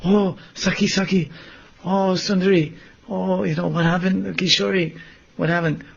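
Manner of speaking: high, worried calling; acted alarm. Sakis running to Radharani, high worried calls.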